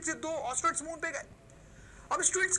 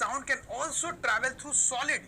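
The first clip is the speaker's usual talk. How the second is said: slow dictation; much slower than usual. Shown as a demonstration. Dictating a line while writing.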